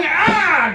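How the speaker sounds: high-pitched comic cry; triumphant yell. Acted. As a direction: let out a big mock yell. Acts out a bout back home.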